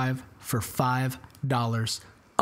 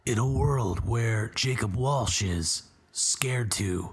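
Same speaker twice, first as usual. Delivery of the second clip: slow, low, whispered trailer voice; hushed mock trailer voiceover; movie trailer guy; 4 semitones lower, slower than usual. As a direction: breathe it like a movie trailer narrator. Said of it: "Is that your best impression?".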